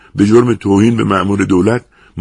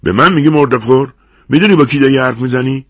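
loud, high, affronted challenge; raised-voice furious demand; harsh outrage; 6 semitones higher, 6 dB louder than usual. A narrator's reading. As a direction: round on the offender angrily. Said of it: "با خشونت عجیبی از جا پرید و گفت".

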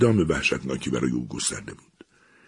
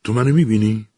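higher, louder uneasy question; nervous question; 7 semitones higher, 6 dB louder than usual. Acted. Eliza asks the hidden voice, uneasy and a bit raised. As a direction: ask it warily, half afraid of the answer. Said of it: "پرسید".

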